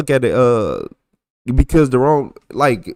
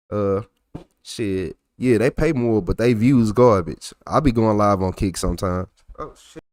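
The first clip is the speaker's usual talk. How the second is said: ordinary talk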